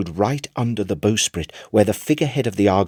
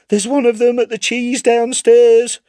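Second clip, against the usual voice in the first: high, loud frantic cry of a haunted man; crying aloud; 14 semitones higher, 5 dB louder than usual. Acted. Chips crying aloud at his club at night, high and loud. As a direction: cry out high and loud as if the rats are right there. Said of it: "he would cry aloud".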